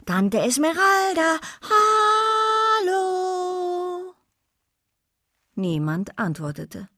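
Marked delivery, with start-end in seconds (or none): 0.0-4.1 high-pitched, drawn-out call. A narrator's reading. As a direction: send the call across an empty courtyard. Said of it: "rief sie immer wieder". (hoch und langgezogen rufend)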